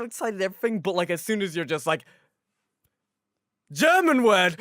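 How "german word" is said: shouted in a hammed-up German accent; loud, higher-pitched German-accented order; mock German command. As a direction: bark it like a German dog trainer. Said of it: "Your dialect and pronunciation was on point".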